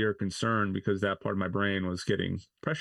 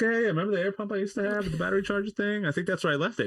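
much higher, fast, breezy mock voice; oblivious casual self; 11 semitones higher, faster than usual. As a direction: play yourself clueless and chipper. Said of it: "I was like".

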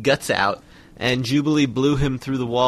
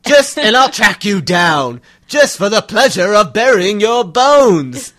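loud, high, slow villain roar; Sabretooth voice; 8 semitones higher, 10 dB louder than usual. Acted. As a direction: bellow the threat savagely. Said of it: "Oh, that hurts my throat".

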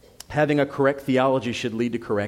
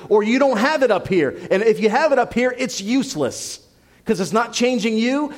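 raised, louder, driving pulpit voice; fiery preaching; 6 semitones higher, 4 dB louder than usual. Acted. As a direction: preach it hard, building to the conviction. Pastor voicing James in full preaching.

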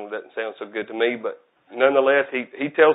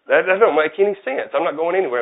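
incredulous, pitched higher and louder; mock indignant; 6 semitones higher, 4 dB louder than usual. Preacher scoffing at a greeting to his congregation, incredulous. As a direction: act baffled by the phrase, pushing it up high.

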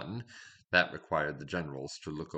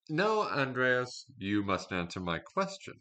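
a touch of stern, slowed-down command; firm king's refusal; 6 semitones higher, 4 dB louder than usual. A narrator's reading. King stops the general leaving, mildly stern. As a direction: draw out a commanding no.

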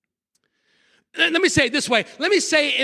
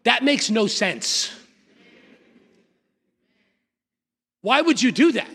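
blunt real talk. Retold or imitated. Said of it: "What I wanna say is".

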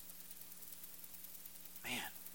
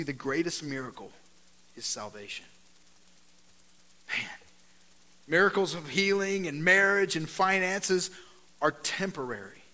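usual speaking voice

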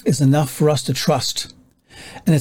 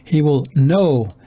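"know" slowed and drawn out; long, deliberate "know"; stress on "know"; slower than usual. Real, not acted. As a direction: underline "know" by stretching it. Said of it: "underline the word know".

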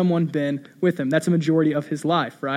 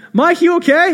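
much higher, louder call across the woods; friends yelling; 12 semitones higher, 6 dB louder than usual. Retold or imitated. Friends calling back through the woods to Mike, yelling. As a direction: shout ahead to a friend. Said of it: "they start yelling".

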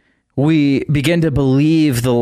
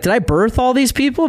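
crying out to God; 9 semitones higher than usual. Retold or imitated. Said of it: "And he cries out to God".

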